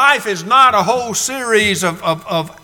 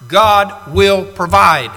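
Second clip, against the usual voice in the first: slow and certain, louder; steady father answering his son; slow, firm answer; 5 dB louder, slower than usual. Acted. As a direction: give Abraham's answer with calm conviction. Abraham answering Isaac.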